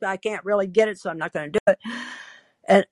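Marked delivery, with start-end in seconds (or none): none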